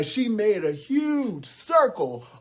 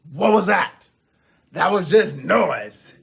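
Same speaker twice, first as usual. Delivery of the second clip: loud, incredulous dismissal; scornful troll; 2 semitones higher, 7 dB louder than usual. Acted. DJ Troll judges Grande Gruff's beatboxing, loud and scornful. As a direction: blast the performance with scorn.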